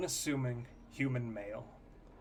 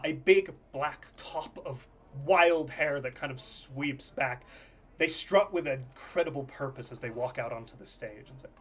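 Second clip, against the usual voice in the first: usual speaking voice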